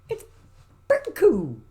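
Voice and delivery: high, loud, silly squeal; high playful squeak. Pretend. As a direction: squeak it out in play. Playful repeats of pretty cool.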